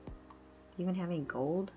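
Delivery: ordinary delivery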